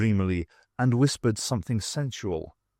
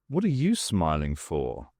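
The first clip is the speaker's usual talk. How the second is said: lightly voiced wary man, pitched down; suspicious question; 7 semitones lower, 3 dB quieter than usual. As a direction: ask it low and guarded. Hero challenges the talking icon.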